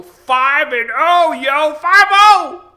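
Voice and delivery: high, very loud bragging, building; triumphant shout. Real, not acted. Vlogger boasting to viewers of his win, triumphant shouting.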